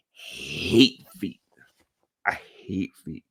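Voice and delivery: low, drawn-out loathing; disgusted. Real, not acted. Podcaster confessing a loathing of feet, disgusted. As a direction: lean on a stretched HATE, pitched low.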